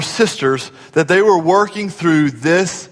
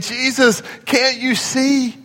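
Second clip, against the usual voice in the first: high, drawn-out tattling complaint; mock frustrated whine; 7 semitones higher than usual. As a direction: imitate a put-upon sister telling on her sibling. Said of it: "in this frustrated tone. She's saying".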